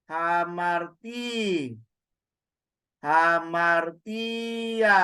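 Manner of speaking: slow step-by-step spelling-out of hamartia; syllable by syllable. Shown as a demonstration. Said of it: "teacher builds the word syllable by syllable".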